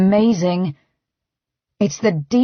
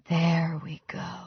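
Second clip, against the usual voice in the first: slow, breathy whisper; soft whisper; 3 semitones lower, 12 dB quieter, slower than usual. A narrator's reading. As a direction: whisper it gently to him, slowly. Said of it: "I say softly".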